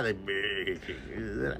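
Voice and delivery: soft, very slow mock English accent; hushed, drawn-out English accent; imitated English accent. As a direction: mimic the English accent softly, BURGER stretched long. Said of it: "He said it like that".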